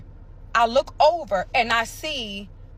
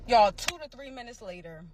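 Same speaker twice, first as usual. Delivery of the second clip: ordinary talk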